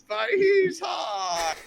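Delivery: very high, drawn-out mock wail; hammed-up wail. Smitten character protesting that he is hot, mock wail. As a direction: wail it loud and long. Acted wail about Strahd's looks.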